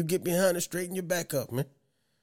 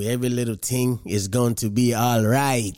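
put-on lower, sing-song Jamaican accent, "ting" for thing; mock Jamaican accent; 4 semitones lower than usual. Host on [name] vibes, quoting the song in a mock Jamaican accent. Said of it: "It's my fool-ass Jamaican voice".

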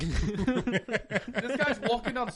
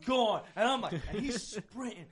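ordinary talk

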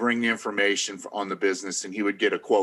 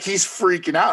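higher, a touch alarmed; older lady worried; 8 semitones higher than usual. Retold or imitated. Older lady telling Ben about Gary, mildly alarmed. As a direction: warn him gently. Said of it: "she's like".

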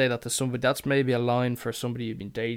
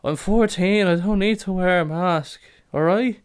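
loud, high mock teen protest; mimicked defiant kid; 7 semitones higher, 8 dB louder than usual. Kid answers woman about wearing a mask, loud mock defiance. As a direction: play a stroppy fourteen-year-old talking back. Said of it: "And he goes".

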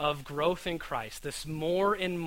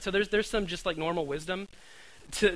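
ordinary talk

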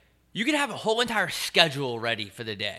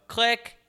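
click sound; 12 semitones higher, 5 dB louder than usual. Retold or imitated. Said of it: "you just go".

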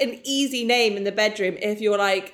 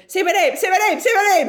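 louder, higher repeated chant; mock loud demand; 10 semitones higher, 8 dB louder, faster than usual. Ex in bed demanding name, loud high mockery. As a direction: ham up his insistent chanting.

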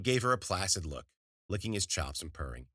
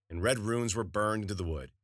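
ordinary delivery

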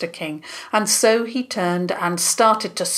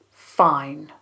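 flat; 3 semitones lower than usual. A narrator's reading. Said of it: "His voice sounded flat".

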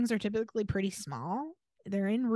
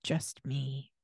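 low, soft deadpan answer; lightly voiced earnest young man; deadpan guy; 8 semitones lower, 6 dB quieter than usual. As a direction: drop it flat and low like a proud missionary. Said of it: "And he says".

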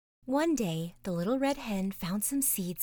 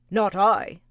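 loud gruff bark of a dog; lazy dog barking; 11 dB louder than usual. Acted. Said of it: "barked the lazy dog".

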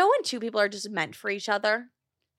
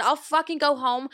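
higher, louder heated retort; heated comeback; 5 semitones higher, 3 dB louder than usual. Retold or imitated. Telling her sister she'll go home, heated. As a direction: fire it back in anger.

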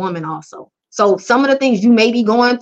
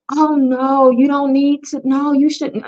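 soft hesitant coach; 4 semitones higher than usual. Retold or imitated. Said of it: "I'm not gonna be that coach who is like".

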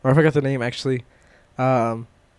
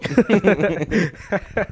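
laughing; 6 semitones higher than usual. Real, not acted.

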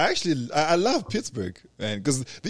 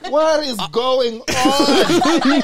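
very slow, high, loud laughing question; overwhelmed, cracking up; 11 semitones higher, 7 dB louder, much slower than usual. Real, not acted. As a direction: stretch out each word in laughter.

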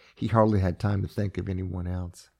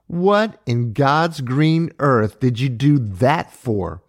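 slow, louder, higher demand; 5 semitones higher, 5 dB louder, slower than usual. A narrator's reading. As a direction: press her for an answer, word by word. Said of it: "Maura demanded".

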